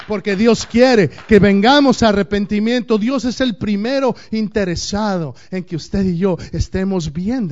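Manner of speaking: loud fiery sermon voice; forceful preaching. Acted. Pastor drives the point home to the congregation, forceful preaching. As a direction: preach it out hard.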